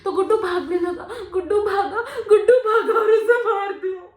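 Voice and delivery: building to a loud, high, tearful cry; anguished outburst. Acted. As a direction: relive it, rising into a wail. Relives brother being killed. (रोते हुए चीख पड़ना)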